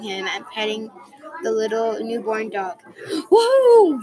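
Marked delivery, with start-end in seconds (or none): 3.3-4.0 loud high whoop; crowd cheer. A narrator's reading. Crowd at the baseball game, loud cheer. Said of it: "yelled the crowd".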